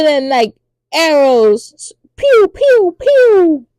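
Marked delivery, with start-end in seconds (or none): 2.2-3.0 arrow sounds. Retold or imitated. Imitating arrows flying.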